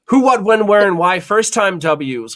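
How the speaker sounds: high, loud game intro; punchy show-host call; show host announcement. Shown as a demonstration. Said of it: "announcing the game segment".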